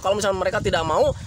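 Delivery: ordinary talk, nothing marked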